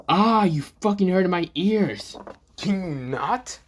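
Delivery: slow, irritated protest, lightly acted; annoyed complaint. Acted girl complaining of hurt ears.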